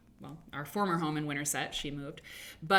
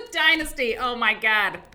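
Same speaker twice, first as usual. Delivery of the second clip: amused; 6 semitones higher, 10 dB louder than usual. Real, not acted. Reacting to "Fonz dynasty.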